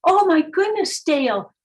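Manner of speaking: high, loud worried outburst; mother panicking about the cost; upset mother. Retold or imitated. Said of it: "And she got all upset".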